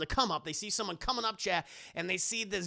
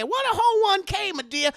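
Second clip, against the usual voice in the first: much higher and louder, squealing "ho one K"; high mock echo; 10 semitones higher, 7 dB louder than usual. Aunt Bam squealing the phrase back at Madea. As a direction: shriek "What a ho one K" back in shock.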